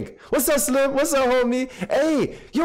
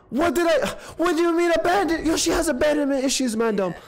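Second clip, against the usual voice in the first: ordinary talk, nothing marked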